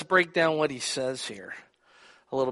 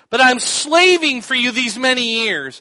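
louder, higher bitter protest; angry complaint; 8 semitones higher, 10 dB louder than usual. Acted. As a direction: throw the years back at him. Son's angry complaint continued.

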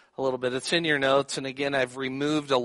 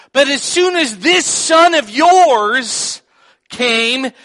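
loud, much higher, furious complaint; frothing anger; 11 semitones higher, 13 dB louder than usual. Acted. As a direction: vent years of resentment at your father. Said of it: "frothing. He is upset".

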